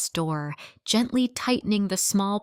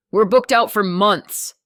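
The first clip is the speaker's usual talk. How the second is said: flat interruption; 8 dB louder than usual. A narrator's reading. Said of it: "the man interrupted flatly".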